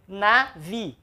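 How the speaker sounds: NAVI said as "na", short gap, "VI"; split into syllables. Shown as a demonstration. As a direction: separate navi into two beats, stressing the last. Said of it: "separou aqui".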